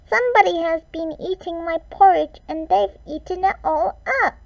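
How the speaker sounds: put-on high, fast, squeaky baby bear; tiny squeak. A narrator's reading. Narrator voicing Baby Bear's high squeak. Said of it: "He squeaked in his tiny, teeny tiny voice".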